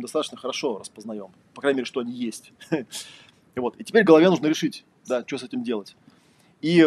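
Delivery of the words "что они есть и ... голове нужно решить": ordinary talk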